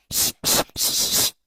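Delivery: sharp imitated shushes; teacher's pet shushing. Teacher's pet hushing kids talking at reading time, officious shushing. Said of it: "we can't forget the teacher's pet".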